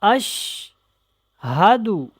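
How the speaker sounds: slow, drawn-out recitation with clear letters; careful tajweed recitation. Shown as a demonstration. Teacher demonstrating the kalima to learners, slow tajweed recitation. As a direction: recite slowly, stretching each word. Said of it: "بہت دھیان سے اس کو سیکھیں".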